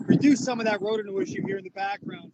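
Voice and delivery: loud and fast over rotor noise; yelling over helicopter. Real, not acted. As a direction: shout quickly over the engine. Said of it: "Try to talk over the rotor noise".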